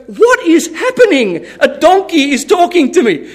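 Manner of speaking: high, hammed-up disbelief at the impossible; mock astonishment. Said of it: "There's no moment where Balaam goes".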